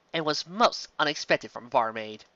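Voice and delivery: ordinary delivery, nothing marked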